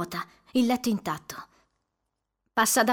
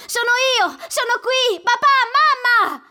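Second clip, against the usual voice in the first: very high, much louder calling; crying out in relief; 9 dB louder than usual. Acted. On the landing, calls to parents in tearful relief. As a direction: call out, overwhelmed with relief. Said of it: "piangendo di sollievo".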